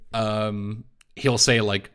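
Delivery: normal talking voice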